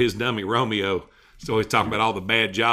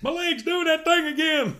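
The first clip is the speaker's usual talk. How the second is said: high squeaky ventriloquist dummy; puppet voice. Pretend. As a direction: chirp it like a dummy. Voicing the dummy.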